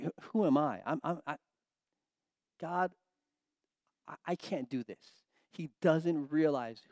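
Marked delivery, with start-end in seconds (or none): 0.0-5.2 hesitant Moses, softer and faltering; a frightened man stammering his doubts; timid stammer. Retold or imitated. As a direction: shrink away, unsure of yourself. Moses doubting himself, voiced.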